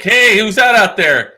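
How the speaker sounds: high, drawn-out call; cheery office greeting. Retold or imitated. Office staff greeting the wife, brightly mimicked. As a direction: sing out a welcome.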